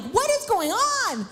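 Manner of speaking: high, drawn-out mock wail; hammed-up overwhelmed mother, words stretched; crying, exasperated. Mother torn between relief and anger, mock wailing. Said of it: "I'm, like, crying".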